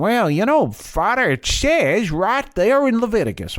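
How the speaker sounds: put-on high-pitched Southern accent. Citing Leviticus to a Father, in a Southern accent. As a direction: play a Southern churchgoer, voice pitched well up. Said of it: "that's a Southern accent again".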